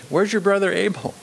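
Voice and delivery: "brother" leaned on within the question; stress on brother. Shown as a demonstration. Preacher rereads God's question to Cain, stressing "brother". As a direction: put the weight on "brother". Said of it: "Again, emphasizing brotherhood".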